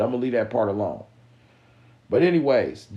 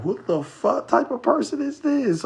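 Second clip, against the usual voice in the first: much higher, incredulous squeak; mock disbelief; 3 dB louder than usual. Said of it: "I'm like".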